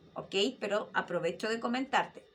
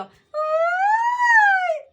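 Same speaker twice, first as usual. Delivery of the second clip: very high, loud squeal; head voice squeal; 14 dB louder than usual. Shown as a demonstration. Teacher demonstrating a squeal in head voice. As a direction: yelp it up high like a startled schoolkid. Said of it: "Tienes voz de cabeza".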